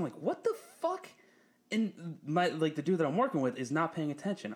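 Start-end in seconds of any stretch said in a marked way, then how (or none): 0.1-1.1 very high, squeaky disbelief. Retold or imitated. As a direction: shoot way up in pitch, stunned. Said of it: "that's what I'm saying".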